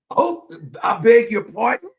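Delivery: loud British-style query, rising at the end; put-on indignant British question; mock British. Reacting to the oyster question, mock British. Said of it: "she turned British on me".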